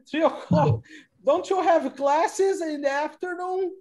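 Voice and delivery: high, loud incredulous question after a laugh; can't believe the answer, pitch shooting up; surprised. Real, not acted. Teacher surprised student games during class.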